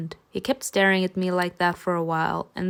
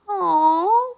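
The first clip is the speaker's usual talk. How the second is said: high sympathetic aw; 11 semitones higher than usual. Acted. Coos at the dragon's sickness.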